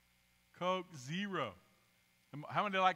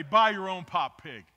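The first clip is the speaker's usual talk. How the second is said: mock rude retort; 11 dB louder than usual. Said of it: "Would you ever say".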